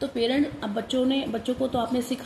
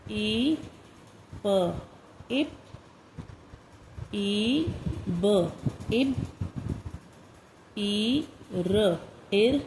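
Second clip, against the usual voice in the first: slow, spaced-out dictation of short sounds; careful classroom dictation, one word at a time; phonics dictation; much slower than usual. Shown as a demonstration. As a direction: read each word clearly for children to write. Teacher dictating phonics words.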